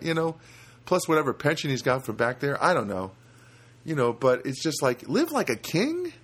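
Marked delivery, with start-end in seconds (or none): 5.1-6.1 incredulous. Real, not acted. Incredulous echo.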